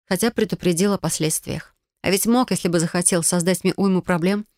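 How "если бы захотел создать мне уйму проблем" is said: ordinary delivery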